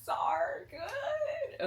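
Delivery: squealing, very high cry of joy on good; ecstatic cry. Real, not acted. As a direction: burst out in a high shriek of delight. Overwhelmed, cry-shout.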